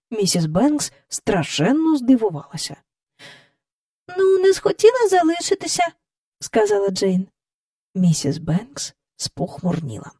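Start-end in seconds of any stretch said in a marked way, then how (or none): 4.2-5.8 Jane's reply. A narrator's reading. Jane answers.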